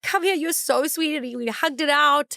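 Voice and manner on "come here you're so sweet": fond mentor. Retold or imitated. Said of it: "he was like".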